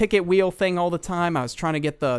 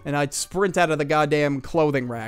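ordinary talk, nothing marked

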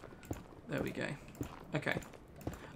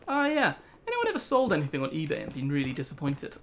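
ordinary talk, nothing marked

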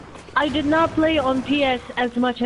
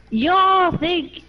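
higher-pitched try at country American; American country accent; 7 semitones higher, 4 dB louder than usual. Acted. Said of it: "trying to remember how they speak".

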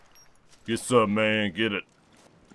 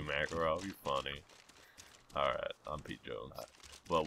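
ordinary delivery

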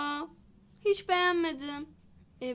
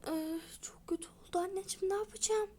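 hushed, quick whisper to mom; a worried little whisper; 2 semitones higher, 9 dB quieter, faster than usual. Real, not acted. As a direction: whisper it fast so the customer can't hear. Child confides hair disaster to mom.

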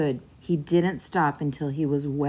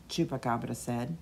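ordinary delivery, nothing marked

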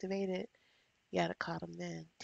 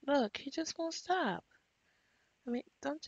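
exasperated; 7 semitones higher, 4 dB louder than usual. Real, not acted. Exasperated at pushy Sim.

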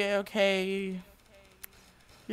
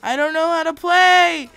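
whining complaint; 6 semitones higher, 9 dB louder than usual. Real, not acted. Whining about the game.

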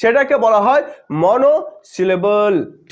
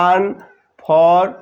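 "আনফর" very slow and lower, split in two; syllable by syllable; 5 semitones lower, much slower than usual. Shown as a demonstration. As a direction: draw out আন, then ফর, with a gap. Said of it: "চারবারে উচ্চারিত হচ্ছে".

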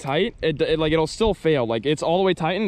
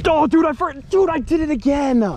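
excited exclamation; 10 semitones higher, 3 dB louder than usual. Real, not acted. Missed hook set, frustrated excitement.

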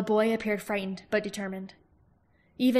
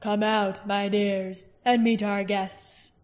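king's voice; 3 dB louder, much slower than usual. A narrator's reading.

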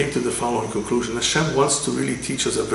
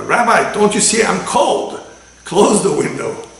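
higher, louder and slow whiny demand; complaining passenger; 7 semitones higher, 7 dB louder, slower than usual. Retold or imitated. Smoker nagging the rabbi to close the window, higher and louder. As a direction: draw out the complaint like a nag. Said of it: "he calls back".

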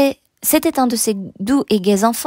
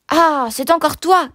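higher, exasperated exclamation; gruff annoyance; 5 semitones higher than usual. A narrator's reading. Convict noticing the boy still there, annoyed. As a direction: draw out the irritated realisation. Man rounds on the child.

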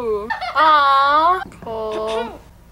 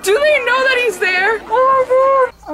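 high, loud fangirl scream; excited screaming; 12 semitones higher, 7 dB louder than usual. Real, not acted. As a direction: shriek it out in delight. Screaming at Gilbert's return.

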